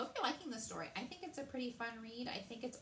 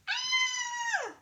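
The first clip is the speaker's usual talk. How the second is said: very high, loud scream of terror; 11 dB louder than usual. A narrator's reading. Queen screaming as the rat speaks to her. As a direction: scream at the sight of him. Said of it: "the queen screamed".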